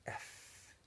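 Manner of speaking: whispered F, unvoiced and very soft. A narrator's reading. Zero unsure of a new sound before the warden, whispering. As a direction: breathe the letter F under the breath. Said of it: "Zero whispered".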